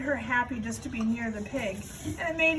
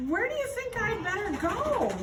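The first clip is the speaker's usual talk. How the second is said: higher, unsure piglet; young pig voice; 9 semitones higher than usual. Acted. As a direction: ask like a timid little pig. Wilbur's voice.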